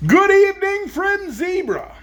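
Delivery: high, slow grand zebra voice. Acted. Zebra greeting the travelers, high and drawn out.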